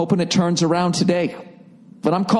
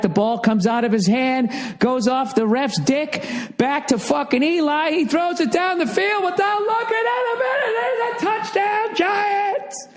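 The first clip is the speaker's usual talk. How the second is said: building to a much higher shouted touchdown call; sportscaster; 12 semitones higher than usual. Pretend. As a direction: ham up the announcer as the play unfolds. Mock sports commentary.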